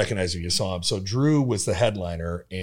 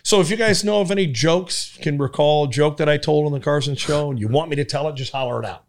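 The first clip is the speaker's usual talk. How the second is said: ironic showman; 5 semitones higher, 4 dB louder than usual. Retold or imitated. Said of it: "he goes".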